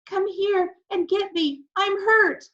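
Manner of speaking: put-on a small hurt child calling up; tiny voice. A narrator's reading. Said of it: "we heard a tiny voice".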